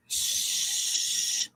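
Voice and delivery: long, soft "shh"; playful hush. Pretend. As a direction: hush them in jest. Playful hush after teasing about corners.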